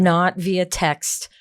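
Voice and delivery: slow, punched not via Slack; stressed not. Real, not acted. Guest insisting on spoken feedback, slow stress. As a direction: lean hard on not, slowly. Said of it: "emphasizing medium, slow".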